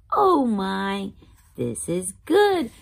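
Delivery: plain reading voice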